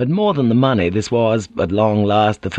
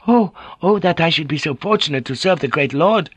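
put-on high, eager young voice; Kiku thrilled; 8 semitones higher than usual. A narrator's reading. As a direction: gush over serving the lord.

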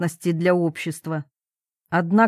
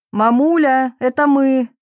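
high, loud call; shouting; 5 semitones higher, 5 dB louder than usual. A narrator's reading. Daughter calls to mamulya inside the tent, shouting. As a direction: call out to be recognised. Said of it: "закричала я".

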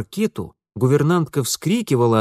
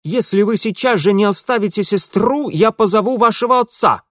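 high, loud indignant threat; stern governess; 9 semitones higher, 6 dB louder than usual. A narrator's reading. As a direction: warn him indignantly, raised voice. Governess threatens angry man.

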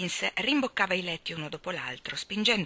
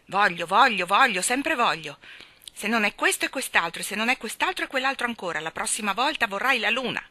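loud, mocking rebuke; exasperated scolding; 5 semitones higher, 7 dB louder than usual. Mary Poppins mocking Michael's demands, loud and exasperated. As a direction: throw his whining back at him.

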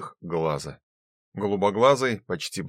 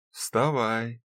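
pleasant female voice. A narrator's reading. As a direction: coax him awake sweetly. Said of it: "приятный женский голос".

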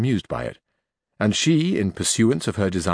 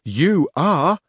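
high, louder page voice; young page voice, raised and loud; 7 semitones higher, 5 dB louder than usual. A narrator's reading. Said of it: "said the page".

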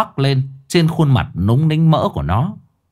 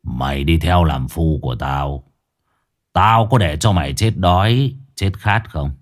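menacing boss interrogating a cowering worker; cold low boss; 6 semitones lower than usual. Acted.

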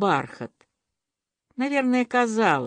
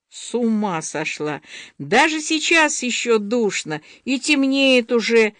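usual speaking voice

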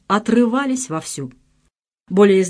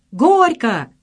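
shouted; 5 semitones higher, 5 dB louder than usual. A narrator's reading. Guests shout at wedding.

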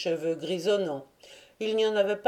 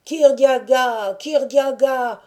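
high, loud, drawn-out name; ringing shout; 7 semitones higher, 8 dB louder, slower than usual. A narrator's reading. Crowd drowning out rival names, shouting Kirdiaga. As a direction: make the name ring out over all others. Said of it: "retentissait plus fort que les autres".

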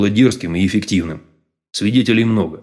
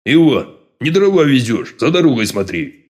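sharp raised shout, higher; shouting at driver; 4 semitones higher than usual. A narrator's reading. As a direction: bark at the coachman. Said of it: "прикрикнул подполковник на ездового".

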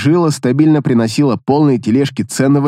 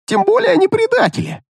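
shout. Acted. Orc shouts defending bounty.

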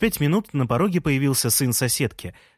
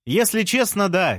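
high, raised answer; carrying, high-pitched reply; shouting back; 8 semitones higher than usual. A narrator's reading. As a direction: call the admission back down. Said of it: "крикнул я в ответ".

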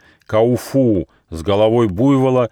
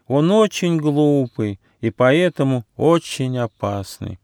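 wounded turtle crying out; 6 semitones higher than usual. A narrator's reading. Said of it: "крикнула раненая черепаха".

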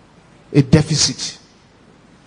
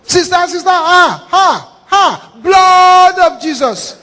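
high, frantic calls to sister; panicked shouting; 12 semitones higher, 5 dB louder, slower than usual. Retold or imitated. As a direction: cry out in terror at the snake. Husband panicking at python.

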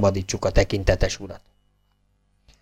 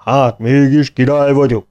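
a light, pleased cry of delight; rejoicing; 3 semitones higher than usual. A narrator's reading. Brother rejoices mildly that he is king after all. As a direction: gloat happily at becoming king. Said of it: "újjongott a bátyja".

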